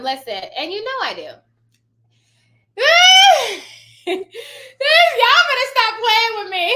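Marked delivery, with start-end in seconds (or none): 2.8-3.8 big shrieking burst of laughter; loud laugh. Real, not acted. Laughing loud at her own mama voice. 4.1-6.6 high, loud, slow mama warning; stern mama voice. Shown as a demonstration. Mom warning her kids to stop playing, stern mama voice. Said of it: "I use my mama voice all the time".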